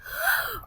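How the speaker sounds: sharp breathy gasp of wonder; delighted gasp. Real, not acted. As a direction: gasp at something lovely. Sees beautiful wings.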